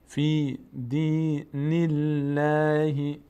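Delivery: very slow chant, light L in "الله"; thin light Allah. Shown as a demonstration. Teacher showing Allah after kasra, L kept light. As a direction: draw out "الله" thinly after the i. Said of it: "پر نمیشه".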